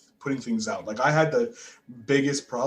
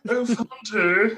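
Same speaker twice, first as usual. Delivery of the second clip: higher, hammed-up French accent; mock French accent, pitched up; horrible fake French accent; 10 semitones higher than usual. Uncle echoing the joke in a bad French accent. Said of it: "with that horrible accent".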